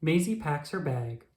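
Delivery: ordinary delivery, nothing marked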